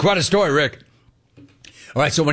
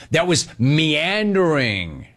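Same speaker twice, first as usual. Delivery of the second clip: slow, stretched "meandering"; big-word delivery; 2 semitones higher, slower than usual. Real, not acted. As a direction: roll out "meandering" grandly, syllable by syllable. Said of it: "Here come my big words".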